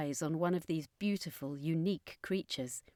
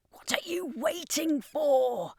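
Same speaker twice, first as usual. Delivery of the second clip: higher, slow mock shout; a commander demanding action, higher and slow; 9 semitones higher, slower than usual. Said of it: "shouted at the circus master".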